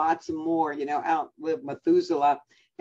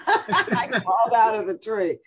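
laughing; 7 semitones higher, 4 dB louder than usual. Retold or imitated. Quoting grandson, laughing.

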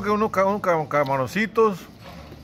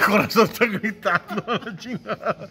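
laughing; 5 semitones higher, 4 dB louder than usual. Real, not acted. Laughing at friend's shouting.

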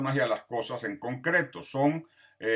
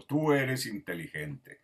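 slow, careful example with tú; model sentence with tú; slower than usual. Shown as a demonstration. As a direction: say it slowly as the tú model. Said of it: "y le digo". (ejemplo lento con tú)

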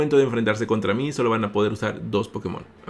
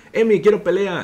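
eager challenge; 9 semitones higher, 5 dB louder than usual. Retold or imitated. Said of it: "Puedes poner".

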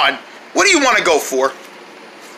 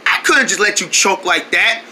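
normal talking voice

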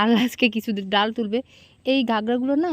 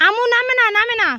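yelling across the room; 12 semitones higher, 5 dB louder than usual. Real, not acted. Mother calling child from distance. (দূর থেকে চিৎকার করে ডাকা)

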